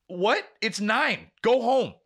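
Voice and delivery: high, incredulous outburst; exasperated, pitch climbing on each phrase; mean-sounding exasperation. Retold or imitated. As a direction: send them off in disbelief. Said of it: "I sound like I'm a really mean person".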